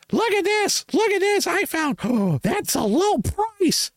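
very high-pitched bargain-finder squeal; hammed-up amazement with a gasp; mock excited yelling. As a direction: show off a find to the whole store. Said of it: "whoever the one that yells that".

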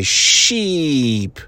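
sheep stretched long with a falling tone; drawn-out sheep. Retold or imitated. Told by the kids to stretch the word out. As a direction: draw out the word sheep slowly. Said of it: "I have to draw it out".

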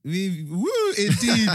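high, loud whooping cheer; excited cheer. Real, not acted. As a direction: whoop it up, full hype. Hyped outro, cheering.

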